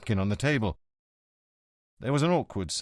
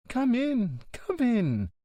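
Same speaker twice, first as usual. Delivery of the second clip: signora welcoming; 13 semitones higher, much slower than usual. Acted.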